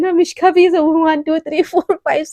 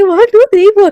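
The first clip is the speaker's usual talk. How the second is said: louder, higher mock count with each number pushed; mocking imitation; 5 semitones higher, 8 dB louder than usual. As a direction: make fun of her clumsy exercise count. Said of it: "ऐसे कौन exercise करता है?".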